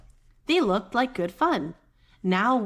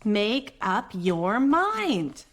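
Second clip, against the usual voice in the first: slow urging; much slower than usual. Acted. Drawn-out urging.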